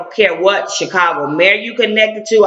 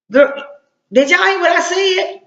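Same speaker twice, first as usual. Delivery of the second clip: incredulous; 10 semitones higher than usual. Real, not acted. Incredulous aside to audience.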